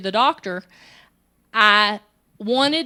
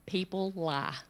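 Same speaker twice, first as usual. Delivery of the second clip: grave quote; 4 semitones lower, 10 dB quieter than usual. Retold or imitated. Quoting lie-detector inventor.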